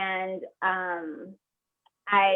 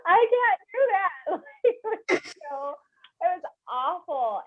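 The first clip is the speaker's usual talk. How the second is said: crying; 9 semitones higher than usual. Real, not acted. Telling the host about her fear, she breaks into real tears. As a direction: voice breaking high as tears come.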